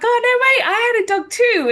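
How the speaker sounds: high, delighted exclamation; excited surprise. Retold or imitated. Telling him she had a dog too, excited and high. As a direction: leap up in pitch, thrilled at the coincidence. Retold excited reply about dogs.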